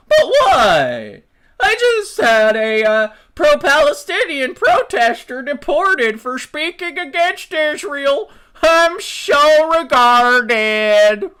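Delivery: high, loud, slow whining; mock whiny hypocrite. Mocking a Nazi excusing himself to critics, high loud whine. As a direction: whine it like a self-pitying fake victim. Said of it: "I can just turn to you and be like".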